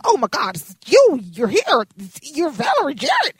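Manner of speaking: loud, high, starstruck squeal; starstruck gush. Retold or imitated. Fan meeting Valerie Jarrett, starstruck loud squeal.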